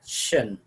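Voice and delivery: "shan" said as a separate sound, as the ending of "-tion". Shown as a demonstration. Teacher showing how I O N endings sound, "shan" pronounced as one separate syllable. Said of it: "gives the sound like".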